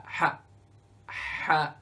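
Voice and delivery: a husky exhaled ha; breathy Ha demo. Shown as a demonstration. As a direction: breathe ha out from the throat. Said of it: "blow on your reading glass".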